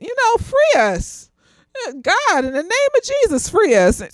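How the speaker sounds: half-joking call on Jesus, rising to a cry; mock prayer. Half-joking prayer to free everybody, mock preacher plea.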